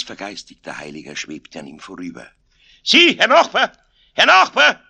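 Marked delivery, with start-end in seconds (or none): 2.8-4.8 very high, loud hailing; shouted call. A narrator's reading. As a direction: yell after a passing neighbour. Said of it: "schrie Aloysius". (lautes Nachschreien)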